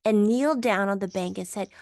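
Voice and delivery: ordinary delivery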